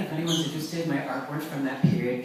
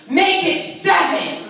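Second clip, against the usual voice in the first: high, loud, slow call from the car; mock shout; 14 semitones higher, 8 dB louder, slower than usual. Woman shouting from her car at mother. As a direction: yell it out the passenger window.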